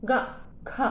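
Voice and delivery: "ग, ख" whispered as sharp puffs of air; breathy consonant demo. Shown as a demonstration. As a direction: push "ग" and "ख" out as bursts of breath. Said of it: "लेकिन जब हम बोलेंगे क".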